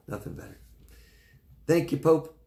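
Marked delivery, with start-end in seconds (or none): 0.1-0.6 hushed whisper of the phrase; a soft unvoiced echo. Real, not acted. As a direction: breathe it out as a whisper. Repeated softer, whispered.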